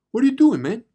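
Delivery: a high, loud yell of "what are you doing"; gruff stranger challenge. Retold or imitated. Stranger on the road yelling at the narrator, loud. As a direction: shout it across the road at him. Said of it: "big guys came out and says".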